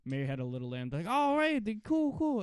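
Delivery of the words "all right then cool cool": high, loud mock cheer; hammed-up approval. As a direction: put on an over-keen high voice. Voicing the cheering party audience.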